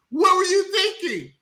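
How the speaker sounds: high shouted "What were you thinking"; exasperated shout. Retold or imitated. Host berating the movie's mother, high exasperated shout. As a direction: yell at her like she lost her mind.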